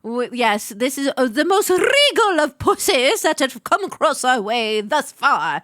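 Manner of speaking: grand, theatrical posh British; posh British accent. Acted. Host praising the regal cat, posh British accent. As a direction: proclaim it in pompous posh British.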